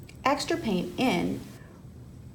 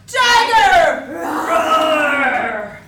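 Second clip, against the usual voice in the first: much higher, much louder shout of the cue; game shout; 4 semitones higher, 12 dB louder, much slower than usual. Acted. Librarian shows the children the Tiger Roar cheer, shouting. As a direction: belt it out like a roaring tiger for the kids.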